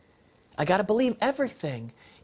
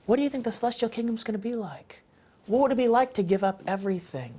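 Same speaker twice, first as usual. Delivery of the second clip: gentle parent question; 4 semitones higher, faster than usual. Retold or imitated. Parent gently asks their kids while watching the film. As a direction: ask it gently, like a curious parent. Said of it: "they can go".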